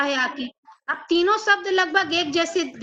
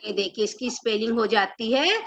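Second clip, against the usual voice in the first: usual speaking voice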